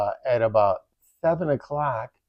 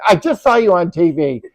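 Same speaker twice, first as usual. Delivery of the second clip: louder, higher exclamation; excited disbelief; 6 semitones higher, 9 dB louder than usual. Retold or imitated. Friend blurting out she just saw them on TV, louder and higher. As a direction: blurt it out amazed.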